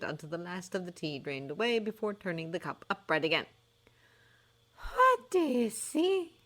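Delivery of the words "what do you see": quiet seer voice. Acted. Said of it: "she said quietly".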